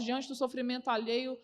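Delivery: usual speaking voice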